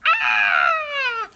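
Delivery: high, loud acted scream; sudden high shriek of fright. A narrator's reading. Wilson peeking round the corner, screaming. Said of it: "screamed Wilson".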